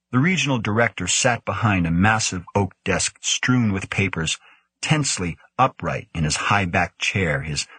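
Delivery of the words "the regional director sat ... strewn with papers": usual speaking voice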